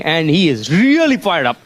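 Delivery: ordinary talk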